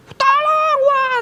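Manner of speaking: very high mock cry for help; imitated scream. Pupils in sudden darkness calling for help, imitated scream. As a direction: imitate panicked pupils squealing, not full volume. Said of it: "Murid-murid langsung teriak".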